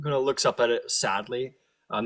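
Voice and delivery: normal talking voice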